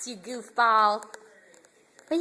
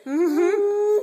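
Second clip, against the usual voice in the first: usual speaking voice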